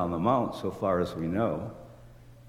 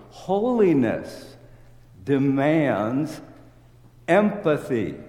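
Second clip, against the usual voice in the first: Holiness drawn out very slow and high, then demands empathy louder; emphatic declaration; 4 semitones higher, 3 dB louder, slower than usual. Real, not acted. Preacher driving home his point to the congregation, slow and emphatic. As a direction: proclaim each word as the sermon's peak. Preacher's climactic thesis line.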